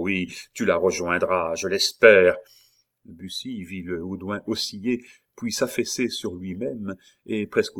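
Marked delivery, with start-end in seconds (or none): 0.0-2.4 restrained menace swelling to loud; mildly angry taunt; howled threat. A narrator's reading. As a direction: build to a forceful last word. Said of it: "hurla Montsoreau".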